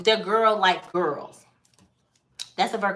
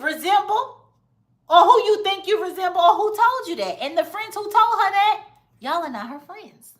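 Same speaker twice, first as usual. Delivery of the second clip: sassy call-out; 11 semitones higher than usual. Acted. Calling out Sarah's friends, high sassy scolding. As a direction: tell her off with attitude.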